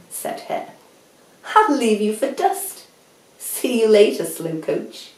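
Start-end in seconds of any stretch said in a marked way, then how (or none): 1.5-5.1 cocky taunt. Acted. Hare taunts Tortoise before leaving.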